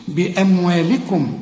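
slow "بأموالكم" with a thin l in "li"; measured recitation, clear light l in "بأموالكم"; light lam. Shown as a demonstration. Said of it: "طلعها أكثر رقة".

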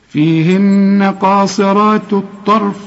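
slow sustained chant, thin "ت" ending "قاصرات" before heavy "الطرف"; light ta among heavies. Shown as a demonstration. Teacher models the full phrase, slow chant with light ta. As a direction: chant it slowly, keeping the t of "قاصرات" delicate. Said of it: "طلعها بالرقة بتاعتها".

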